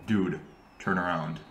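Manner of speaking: a coworker murmuring a warning in the basement; urgent hushed. Retold or imitated. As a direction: urge your friend quietly not to be overheard. Gavin urging quietly in the basement.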